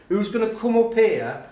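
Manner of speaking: rally volunteers from the front; calling on class. Retold or imitated. Teacher asking pupils for a volunteer, calling out.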